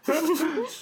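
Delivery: sudden squealing laugh, very high; real laughing squeal; high burst of laughter. Real, not acted. Bursts out laughing at own joke.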